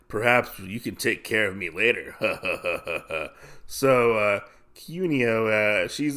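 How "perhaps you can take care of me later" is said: creepy line capped by put-on chuckle; sleazy scumball manager. Inn manager propositions his worker, sleazy. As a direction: leer it, then chuckle villainously.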